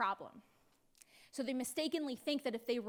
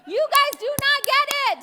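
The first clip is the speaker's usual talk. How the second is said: loud, higher, disbelieving; exasperated God; 6 semitones higher, 11 dB louder than usual. Retold or imitated. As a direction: roll your eyes at them out loud. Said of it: "God is like".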